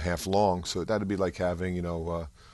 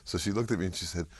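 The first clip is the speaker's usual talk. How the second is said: normal talking voice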